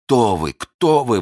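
astonished loud question; exclaiming. A narrator's reading. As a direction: cry out, shaken. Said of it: "воскликнул Фельтон".

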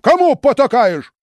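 very high, loud scolding cry; furious yell. A narrator's reading. Philip yelling at his nephew. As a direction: roar at the traitor nephew. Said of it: "заорал и Филипп".

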